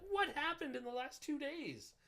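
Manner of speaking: stunned, draw it out high; shocked disbelief. Retold or imitated. Driver seeing his account deactivated, shocked and high.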